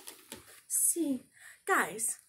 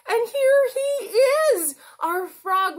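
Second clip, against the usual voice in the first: excited reveal; 13 semitones higher, 10 dB louder than usual. Acted. Presents the frog puppet.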